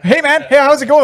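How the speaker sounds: high, casual hello; Gretzky impression. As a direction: chat to Connor in a high, easy voice. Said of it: "And it's like".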